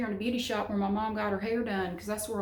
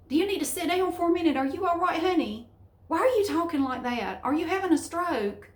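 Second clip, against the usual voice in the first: mock concern; 8 semitones higher, 4 dB louder than usual. Mocking condescending northerners.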